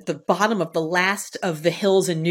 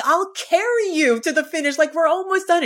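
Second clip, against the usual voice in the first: eager encouragement; 9 semitones higher than usual. Retold or imitated. Urging the struggling runner on.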